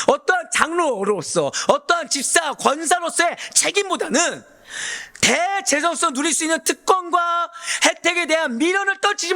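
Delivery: high-pitched, driving sermon delivery; impassioned preaching. Real, not acted. Preacher expounds Annas's failure to the congregation, fervent preaching. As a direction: preach insistently, stretching key words high.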